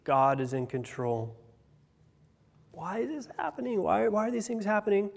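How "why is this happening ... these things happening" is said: high, plaintive cry of why; pleading questioning. Acted. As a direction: voice a bewildered plea, pitched well up. Voices people's questioning to God.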